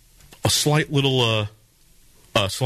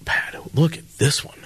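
hushed aside; 2 semitones higher than usual. Real, not acted.

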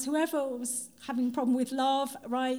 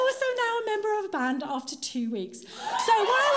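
laughing; 7 semitones higher than usual. Real, not acted. Excited laughing announcement of band.

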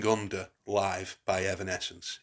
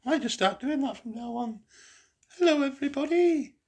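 jokey, much higher falsetto-like demo; 7 dB louder than usual. Shown as a demonstration. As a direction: greet the listeners in a much higher, louder mock voice. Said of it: "speak a bit higher".

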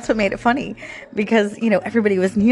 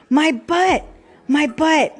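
higher, a light imitation of a little boy calling out; son's loud call; 7 semitones higher, slower than usual. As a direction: lightly play the child blurting it out. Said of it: "really, really loud".

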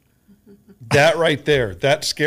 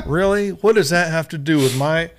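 mock outrage; 5 semitones higher, 6 dB louder than usual. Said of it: "It's like".

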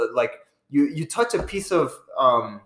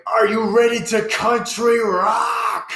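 loud, drawn-out hammed-up country rock shout; country rock singer; 8 semitones higher, 4 dB louder, slower than usual. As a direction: holler it slowly like a country rocker. Mocking the country rock selection.

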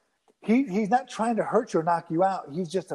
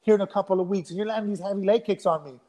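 higher-pitched, mildly indignant retold complaint; mad protest; 4 semitones higher than usual. Fighter objecting to heavy leg kicks, mildly mad protest. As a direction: act out an annoyed protest, understated. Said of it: "he was getting me mad".

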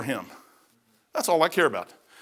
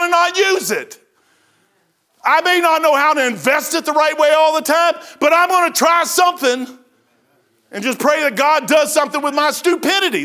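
forceful, raised-pitch pulpit declaration; impassioned preaching; 6 semitones higher, 4 dB louder than usual. Real, not acted. Preacher vowing before the congregation not to waste his gift, impassioned preaching. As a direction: press every phrase with conviction.

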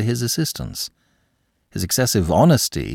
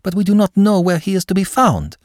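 urgent plea; 11 semitones higher, 4 dB louder than usual. Acted. Aline pleading with uncle.

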